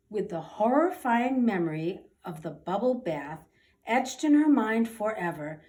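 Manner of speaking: usual speaking voice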